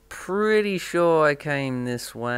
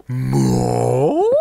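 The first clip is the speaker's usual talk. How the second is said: loud rising "More?" in a strange voice; overacted shouted "More?"; hammed-up shout; 2 semitones higher, 9 dB louder than usual. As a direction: bellow the word like a bad actor. Movie quote mock shout.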